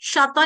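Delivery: छाता said as शाता, with "sh"; "sh" in place of "chh" in छाता; mispronounced छ. Shown as a demonstration. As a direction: soften the छ of छाता into a श. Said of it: "इसको छाता नहीं बोलेंगे".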